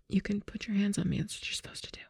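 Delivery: hushed, breathy reassurance; whispered aside. Real, not acted. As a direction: whisper a quick cue to your partner. Whispered cue to scene partner.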